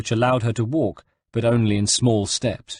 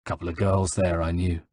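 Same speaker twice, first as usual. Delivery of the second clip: offhand, lower reply; casual; 4 semitones lower, 3 dB quieter than usual. A narrator's reading. Ezio explains his French to his friend, casual. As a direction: toss it off casually. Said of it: "Ezio replied casually".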